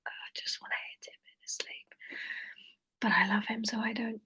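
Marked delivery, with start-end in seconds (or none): 3.0-4.2 very quiet, near-whispered aside; hushed. Real, not acted. Streamer murmuring an aside before reading, hushed. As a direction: say it barely aloud, almost whispering.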